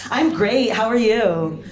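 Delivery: mock-naive perky greeting; oblivious cheer. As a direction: answer brightly, missing the flirtation entirely. Oblivious chirpy reply to flirting.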